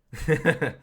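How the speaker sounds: high, loud burst of laughter. Real, not acted. Joking about the messy position.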